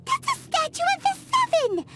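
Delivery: high, scandalized outburst; shocked protest. Acted. Companion objects to destroying a divine statue, shocked.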